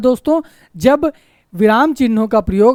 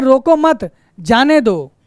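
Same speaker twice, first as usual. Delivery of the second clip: "रोको मत" as one higher-pitched unit, then "जाने दो" apart; रोको मत, stop, जाने दो; pause after मत; 3 semitones higher, slower than usual. Shown as a demonstration. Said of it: "अर्थात उसको जाने दिया जाए".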